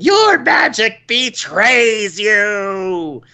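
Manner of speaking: high, very drawn-out villain cry; annoying, swooping mock boss shout; Sindragosa. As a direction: wail it slowly like an over-the-top boss. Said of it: "second one has got to be Sindragosa with".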